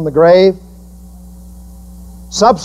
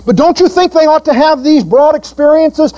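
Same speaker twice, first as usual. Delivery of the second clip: mock objection; 11 semitones higher than usual. Voicing objecting parent.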